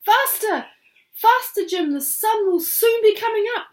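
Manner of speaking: very high, loud urgent shout. Acted. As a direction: yell to hurry the eagle. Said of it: "shouted Dingle Mouse".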